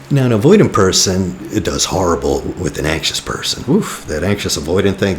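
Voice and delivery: normal talking voice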